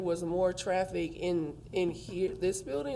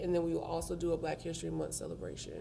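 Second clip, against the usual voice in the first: ordinary talk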